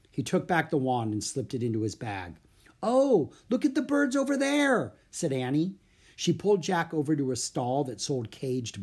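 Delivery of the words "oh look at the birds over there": high-pitched delighted discovery; excited exclamation. Acted. Annie spotting birds at a market stall, excited. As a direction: point it out in eager excitement.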